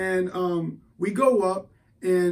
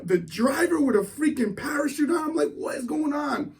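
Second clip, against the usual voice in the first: rising panic; 6 semitones higher than usual. Retold or imitated. Panic building on the plane.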